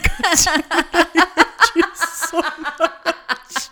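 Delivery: loud, high yell through laughter; laughing mock fury. Wife reacting to husband's confession, laughing outrage. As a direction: shout the curse while cracking up.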